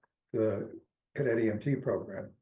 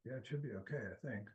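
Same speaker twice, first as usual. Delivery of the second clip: much softer, barely carrying; too quiet; 13 dB quieter, faster than usual. Real, not acted. Remote caller answering the chair about audio trouble, very quiet. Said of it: "speak up 'cause you're a little quiet".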